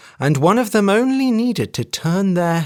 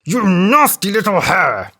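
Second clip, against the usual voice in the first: elephant roaring at the hare, louder; furious outburst, a touch higher; angry elephant cry; 4 semitones higher, 4 dB louder than usual. A narrator's reading. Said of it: "cried the elephant".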